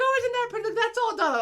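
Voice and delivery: high, loud oblivious delight; admiring mom. Retold or imitated. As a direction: imitate mom gushing over pretty lights. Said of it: "She goes".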